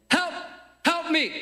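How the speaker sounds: high-pitched desperate call; cry for help. Acted. Leper calling to the town, high cry for help. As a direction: cry out for rescue.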